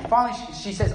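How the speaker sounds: ordinary talk, nothing marked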